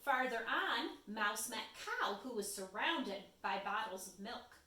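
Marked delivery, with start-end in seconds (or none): none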